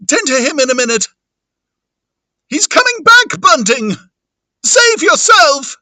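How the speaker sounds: very high, panicked shouting; Mister Cuss. Acted. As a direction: scream the warning in full panic. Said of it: "Now, Mister Cuss".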